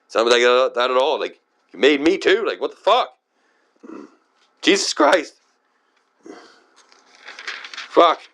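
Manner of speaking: high, incredulous swearing; fed-up protest climbing into a high-pitched curse; exasperated outburst. Real, not acted. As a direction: let the pitch shoot up in disbelief.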